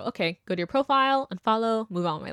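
quiet, fading muttering, dropping away to almost nothing; muttered to herself. Retold or imitated. Unfollowing a Trump voter, muttered quietly to herself. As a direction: mumble it under your breath as you click unfollow. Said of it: "I would literally just go".

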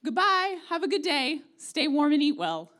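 cheery dismissive send-off to a hungry brother; breezy mock goodbye. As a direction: chirp it like an empty farewell. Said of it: "and you say".

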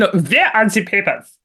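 a hint of a German officer demanding papers; mock German accent. Host mocking a German border guard, lightly accented. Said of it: "I'm sure it's racist to do that accent".

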